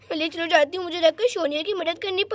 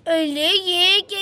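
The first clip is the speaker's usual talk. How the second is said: ordinary talk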